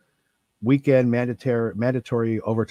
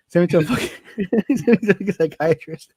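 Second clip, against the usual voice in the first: laughing through the words; 8 semitones higher than usual. Real, not acted. Telling friends he got sent away, laughing. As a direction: laugh it out in disbelief. Laughing at his own story.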